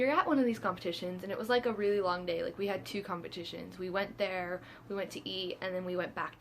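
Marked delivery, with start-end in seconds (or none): none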